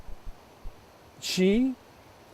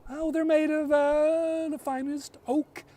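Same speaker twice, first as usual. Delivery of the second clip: put-on higher, hesitant girl's voice, bluffing; high unsure girl; 10 semitones higher, slower than usual. A narrator's reading. Girl bluffing to the sister, hesitant and higher. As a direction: fake a confident answer, higher. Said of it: "Tell me, what are his arrows".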